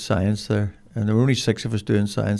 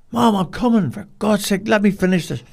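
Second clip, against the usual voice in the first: high, exasperated shout; irritated shout back; 9 semitones higher than usual. Retold or imitated. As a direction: yell back, fed up with being called.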